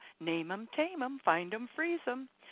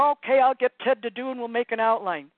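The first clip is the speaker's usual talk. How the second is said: louder, higher, brisk ego voice; busy planner voice; 4 semitones higher, 11 dB louder than usual. Acted. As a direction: play an officious taskmaster taking over. Acting out a busy planner part.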